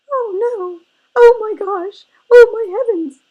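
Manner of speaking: very high, very loud tiny bear cub cry; piercing, high-pitched little cub wailing in alarm; wee little voice. A narrator's reading. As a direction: squeal it out as a panicked baby bear. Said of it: "he cried in his wee little voice".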